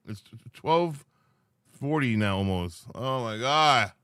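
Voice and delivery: ordinary talk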